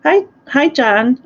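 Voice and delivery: high, loud happy hello; excited greeting. Real, not acted. Welcoming a new viewer, a loud happy hello. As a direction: greet her brightly and loudly.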